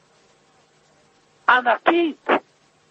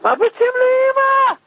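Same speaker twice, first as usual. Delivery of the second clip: crying out; 12 semitones higher than usual. Retold or imitated. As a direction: cry it out, shocked the mother is hit. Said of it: "התחיל לצעוק ולבכות".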